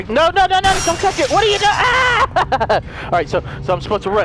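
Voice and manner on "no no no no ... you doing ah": high panicked protest rising to a scream; panicked shouting. Real, not acted. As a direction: yell in panic at an attacker. Boss attacks him in the game.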